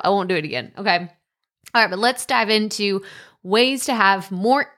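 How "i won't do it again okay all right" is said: ordinary talk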